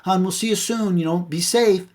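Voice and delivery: high-pitched sweet goodbye, imitated; wife signing off. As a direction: mimic a wife's caring phone sign-off. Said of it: "So I kept saying".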